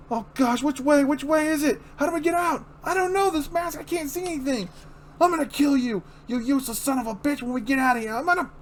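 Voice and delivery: high frantic voice, shouting blind under the mask; terrified then threatening, all in a panic. Acted.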